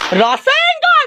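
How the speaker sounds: very high, loud attack shout; battle cry. Acted. Child calls out an attack in a play battle, high battle cry.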